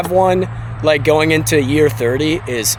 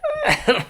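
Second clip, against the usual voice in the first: high laugh; 5 dB quieter than usual. Real, not acted. Laughs at own joke.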